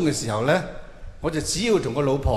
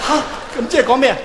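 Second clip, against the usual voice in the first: startled high outburst; a dead man shouting in confusion at heaven; 8 semitones higher, 4 dB louder, much faster than usual. Acted. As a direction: blurt it out, high and loud, baffled.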